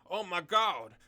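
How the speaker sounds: mock-screamed outburst from a comic blurb; acted scream. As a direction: shout it out as a hyped-up reading. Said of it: "will have you screaming".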